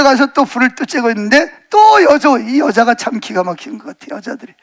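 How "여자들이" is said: normal talking voice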